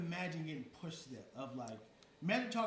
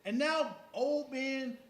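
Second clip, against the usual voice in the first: ordinary delivery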